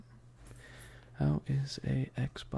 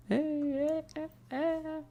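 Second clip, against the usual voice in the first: playful high hey. Acted. Calling chat playfully.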